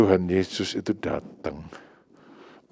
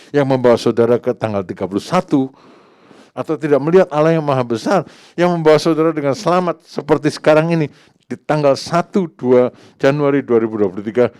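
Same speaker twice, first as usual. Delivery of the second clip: loud, high, driving preacher's call; fervent preaching; 5 semitones higher, 7 dB louder than usual. Real, not acted. Preacher stirring the church, loud and fervent. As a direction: raise the voice and drive it home to the congregation. Preacher building to full force.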